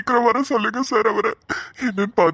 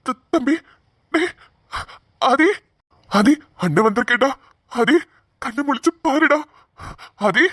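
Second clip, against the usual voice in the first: higher, sobbing calls to a brother who won't wake; crying out; 4 semitones higher than usual. Acted. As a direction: break into tears calling his name. Brother weeps over unconscious sibling. (அழுதுகொண்டே தம்பியை அழைத்தல்)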